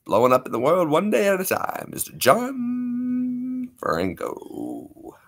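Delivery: slow drawn-out ring announcer name; announcer hype. Acted. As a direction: stretch out the name.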